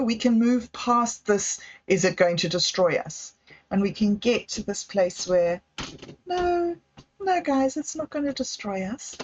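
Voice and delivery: ordinary delivery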